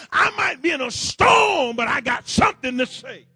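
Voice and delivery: shouted, rising sermon cadence; full-force preaching. Acted. Preacher rallying his congregation, preaching at full force. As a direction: preach it hard to the pews.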